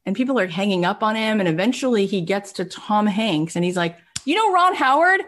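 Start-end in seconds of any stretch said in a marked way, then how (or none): 4.3-5.2 very high, louder excited question; acted-out breathless hustler; eager name-drop. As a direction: pitch a star's name brightly down the phone. Acting out Brian phoning Tom Hanks.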